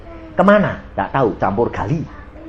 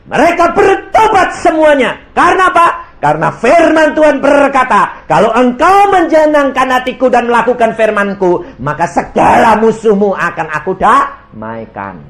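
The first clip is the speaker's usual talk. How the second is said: high, loud preaching over the congregation; full-force preaching; 11 semitones higher, 7 dB louder than usual. Acted. Preacher proclaiming to his congregation, loud and high. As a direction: proclaim it to the whole church. Preacher quoting scripture loudly.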